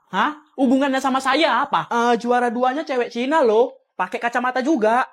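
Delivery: usual speaking voice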